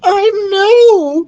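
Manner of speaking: loud, very high squeal; excited cry. A narrator's reading. Queen mishears son's remark, squealing. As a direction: cry it out in delight. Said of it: "she cried".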